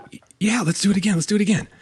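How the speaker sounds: imitating a thrilled kid; excited child. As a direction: beg to ride again, bouncing with excitement. Voicing excited daughter.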